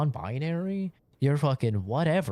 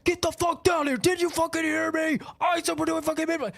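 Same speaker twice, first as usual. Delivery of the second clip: toxic yelling player; 13 semitones higher than usual. Retold or imitated. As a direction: scream orders at the team. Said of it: "I get those people in my game".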